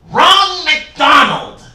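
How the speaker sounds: slow, loud, high squawking yell; a hammed-up Gottfried screech; Gilbert Gottfried impression. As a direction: bellow the name like Gilbert Gottfried. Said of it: "Gilbert Gottfried as Storm Chaser".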